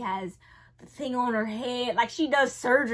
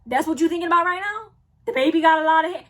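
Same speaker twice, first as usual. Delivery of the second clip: fast, louder, higher outrage; incredulous retort; 5 semitones higher, 9 dB louder, much faster than usual. Retold or imitated. To the doctor praising the hair: fast incredulous snap. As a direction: throw the question back, amazed.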